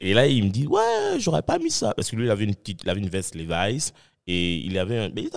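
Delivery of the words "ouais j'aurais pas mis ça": imitating the guy. Guy repeats his clothing jab.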